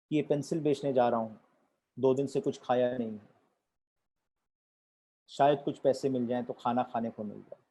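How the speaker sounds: soft, low, subdued reply; hungry poor child. Acted. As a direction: answer softly and low, as a child who has not eaten. Poor hungry child answers in story. (भूखे बच्चे की धीमी, दबी आवाज़)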